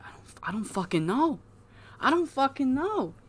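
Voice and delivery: high, soft mock-helpless voice; clueless protest. Acts out Crippler's clueless reply.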